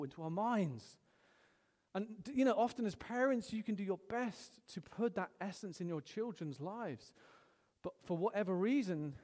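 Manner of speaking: usual speaking voice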